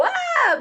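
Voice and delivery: high, loud "ah" sliding down; mock falling scream. Teacher acts a kid dropped off a seesaw, high mock scream. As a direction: act out a sudden drop with a scream. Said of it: "and you'd go".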